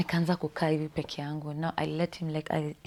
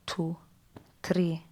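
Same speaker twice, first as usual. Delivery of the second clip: ordinary delivery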